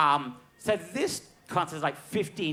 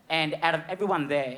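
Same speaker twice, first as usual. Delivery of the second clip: usual speaking voice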